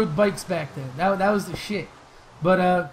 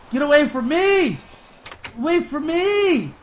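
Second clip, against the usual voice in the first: frantic shouting; 6 semitones higher, 3 dB louder than usual. Real, not acted. Gamer fleeing the monsters, frantic shouting. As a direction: shout it high and loud as you run.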